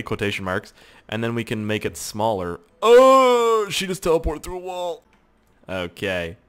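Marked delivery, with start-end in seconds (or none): 2.8-5.0 loud high burst of surprise; startled. Real, not acted. Watching his sim walk through a wall, loudly surprised. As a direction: blurt it out, amazed.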